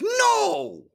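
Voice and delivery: shrieking high coach outburst; Coach Greg voice. Retold or imitated. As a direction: bark a piercing "No!" way up high. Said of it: "behind the camera in my own house".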